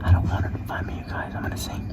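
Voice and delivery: very soft hiding whisper. Real, not acted. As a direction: barely breathe the words. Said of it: "hiding, whispering".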